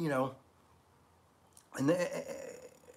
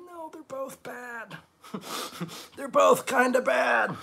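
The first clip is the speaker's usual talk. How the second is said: high, half-laughing mock protest; laughing exasperation; 8 semitones higher, slower than usual. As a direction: throw up your hands, laughing. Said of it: "And I'm like".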